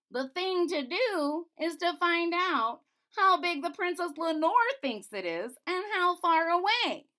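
a gentle jester musing aloud; court jester voice. Acted.

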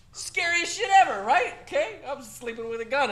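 high, loud, laughing exclamation; laughing outburst. Real, not acted. Teacher to class, laughing at how creepy calm is. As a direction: crack up and shout it out.

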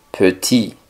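Petit said "puh-TEE", first vowel closed; closed E, silent T. Shown as a demonstration. Teacher showing learners the French E, Petit as "puh-TEE". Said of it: "sino un poco más cerrada".

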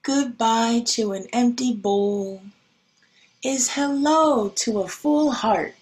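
usual speaking voice

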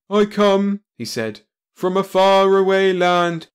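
high, loud, slow proclamation; grand declaration. Acted. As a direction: announce it like a hero.

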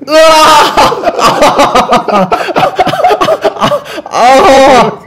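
high drawn-out roar; battle roar. Acted. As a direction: let out a long wild roar. Character leaps, falls, screams.

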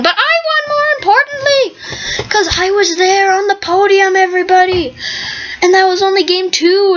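high, bubbly bragging; giddy high gloating. Real, not acted. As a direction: gloat happily to everybody. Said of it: "I'm way too happy right now".

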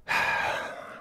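long, voiceless sigh; exasperated sigh. Real, not acted. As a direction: let out a fed-up breath. Exasperated pause in a rant.

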